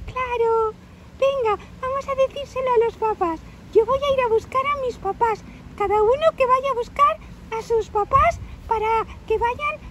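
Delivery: put-on high, lively, loud child's voice; rally your friends in an eager little-girl voice; little girl María. Little girl offering to find the bear's mother, high eager voice. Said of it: "Dice María".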